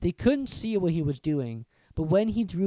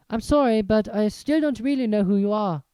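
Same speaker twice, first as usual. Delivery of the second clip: put-on young Harry; 6 semitones higher, 7 dB louder than usual. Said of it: "he said".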